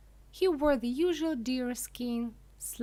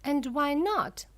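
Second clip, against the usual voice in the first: a mild, higher shout; 5 semitones higher, 6 dB louder than usual. A narrator's reading. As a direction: push it out louder and higher. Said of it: "shouted Missus Gloop".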